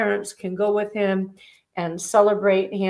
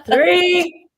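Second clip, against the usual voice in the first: dramatic countdown; 7 semitones higher, 7 dB louder, much slower than usual. Acted. Countdown for absent winner.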